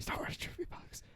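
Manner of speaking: hushed whispered show title; very soft, breathy segment tag. Pretend.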